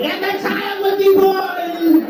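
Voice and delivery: very high, slow, far witch shout; high, slow witch shriek; witch prophecy shriek. Acted. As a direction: screech it like a possessed witch.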